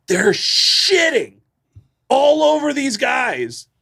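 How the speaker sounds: drawn out way up high; amused high drawl; high, slow amused. Real, not acted. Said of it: "most entertaining shows".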